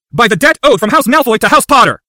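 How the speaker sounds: high, loud, forceful shout. A narrator's reading. Harry invokes a debt before the Wizengamot, shouting. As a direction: shout the oath out across a hall. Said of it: "clenched his fists beneath the bench and shouted".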